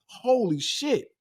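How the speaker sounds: slow, high-pitched stunned reaction; retold amazement. Everybody in the house watching the award, retold amazement. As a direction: imitate a roomful of amazement, unshouted. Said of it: "I screamed so loud, everybody in the house".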